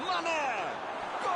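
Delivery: high, drawn-out goal call; explosive goal commentary. Acted. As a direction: yell the goal like a stadium commentator. Goal scored in football match. (narração explosiva de gol)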